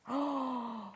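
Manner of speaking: soft, drawn-in breath of delight; admiring gasp. Real, not acted. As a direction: gasp quietly at a lovely find. Admiring a fabric in red.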